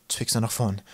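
plain reading voice

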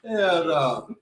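usual speaking voice

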